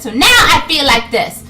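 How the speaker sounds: exasperated retort. Acted. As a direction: burst out at the end of a rant. Acted exasperated retort builds up.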